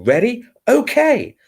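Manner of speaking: very high, squealed cheer; sudden high-pitched mock starting call; alarming shout-out. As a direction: squeal it brightly to startle people. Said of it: "just to alarm people".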